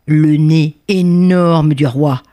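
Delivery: ordinary delivery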